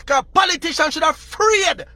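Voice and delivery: usual speaking voice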